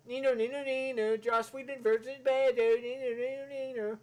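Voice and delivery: mock the critic like a jeering troll; trolling taunt. Mocking the critic as a troll, sing-song taunt. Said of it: "like a fucking troll".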